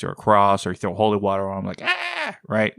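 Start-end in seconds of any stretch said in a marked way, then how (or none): 1.8-2.4 short, high-pitched mock scream; small pretend squeal of pain; mock vampire shriek. Host acting out a vampire hit by holy water, mock shriek.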